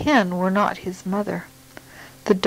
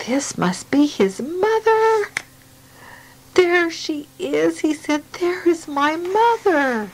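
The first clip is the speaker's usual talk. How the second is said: put-on excited baby bird; 8 semitones higher than usual. Baby bird excitedly spots mother.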